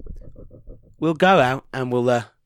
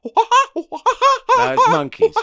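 monkey call; 4 dB louder, much slower than usual. Pretend. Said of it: "Is that a howler monkey?".

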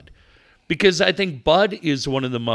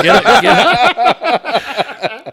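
loud, slow drawn-out teasing command; hammed-up slow shout; mock order; 9 semitones higher, 10 dB louder, much slower than usual. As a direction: bellow it out slowly like a joke order. Teasing a friend to move.

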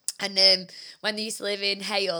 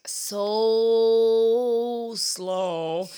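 slow drawl; 5 dB louder than usual. Retold or imitated. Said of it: "And we were like".

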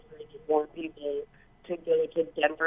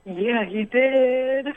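drawn-out, higher and louder, delighted; excited confirmation; 7 semitones higher, 6 dB louder, much slower than usual. Real, not acted. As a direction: stretch it out with real excitement. Delighted caller confirms host's recognition.

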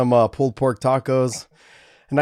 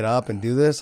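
normal talking voice

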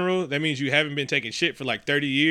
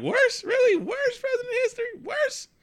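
mocking disbelief, pitched way up; incredulous high squeak. Mocking the outraged crowd in a squeaky high disbelief. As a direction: squeal the word worst in disbelief. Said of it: "I'm like".

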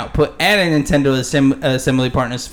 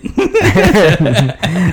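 laughing; 5 semitones higher, 5 dB louder than usual. Real, not acted. Laughing at own remark.

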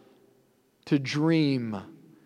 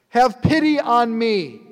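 higher and louder desperate plea; calling out more; 6 semitones higher, 5 dB louder than usual. Acted. Blind man cries out to Jesus again, louder and more desperate. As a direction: cry it out harder, begging. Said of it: "he calls out all the more".

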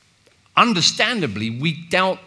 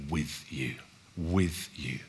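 soft echo; 8 semitones lower, 7 dB quieter, slower than usual. Shown as a demonstration.

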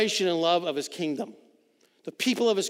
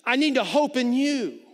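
lifted, higher and louder plea to God; pleading prayer; 7 semitones higher, 4 dB louder than usual. Retold or imitated. A psalmist pleading with God for hope, higher and louder. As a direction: cry out to God, begging him. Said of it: "And he looks at God and he says".

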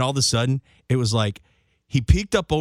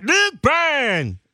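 much higher, louder name call; 11 semitones higher, 4 dB louder than usual. Shown as a demonstration. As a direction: shout the name like a promo. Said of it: "like, yeah".

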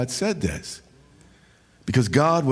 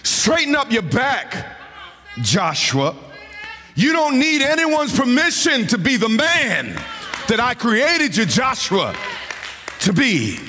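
preaching at full force; 9 semitones higher than usual. Acted. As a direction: belt it out like a revival preacher.

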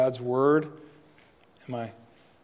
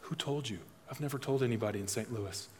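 hushed secret; 2 semitones lower, 9 dB quieter, faster than usual. Retold or imitated. Man in small group hushes, confessing to pastor, dropped to a soft hush. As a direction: confide it softly, like a secret nobody should hear. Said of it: "he leaned in and goes".

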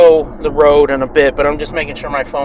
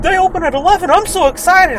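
high, giddy voice; mock a gushing fan; excited fan voice; 14 semitones higher than usual. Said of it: "somebody's like".

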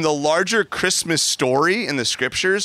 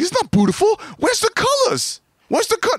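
put-on very high, louder squeaky child voice; complaining little son; 4 dB louder than usual. Son Zion complains there are no colors, very high child whine. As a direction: squeal the complaint like a small kid.

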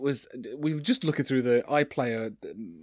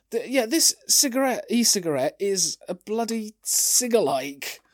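higher, louder, amused disbelief; can't believe what they used; incredulous; 7 semitones higher, 5 dB louder than usual. Real, not acted. Mocking outdated picture.

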